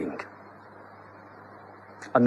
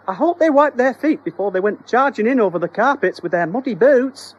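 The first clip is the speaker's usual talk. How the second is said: much higher, prim housewife; high fussy wife; 11 semitones higher, 4 dB louder than usual. Acted. Wife worries about the carpets, high and prim. Missus Doherty voiced.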